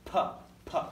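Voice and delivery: "P." with a pressurized puff of air; strong aspirated "P."; hard p. Shown as a demonstration. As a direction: pop "P." hard from built-up pressure. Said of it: "this is a hard p".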